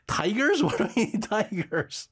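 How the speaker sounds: high startled "Tigers?" into laughter; incredulous, laughing; mock surprise. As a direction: react in amused disbelief. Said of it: "I was like".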